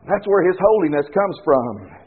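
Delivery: ordinary delivery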